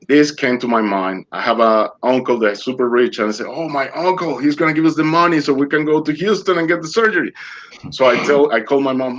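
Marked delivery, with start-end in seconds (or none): 3.5-7.3 high, quick burst of hope; retold excited hope. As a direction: rush it out high, like a sudden bright idea. Retold hopeful idea of rich uncle.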